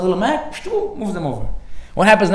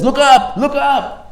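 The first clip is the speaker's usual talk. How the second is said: calling look up; 9 semitones higher, 4 dB louder, much slower than usual. Retold or imitated. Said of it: "and says".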